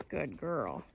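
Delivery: low, soft praise; soothing a dog. Real, not acted. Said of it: "praising the dog".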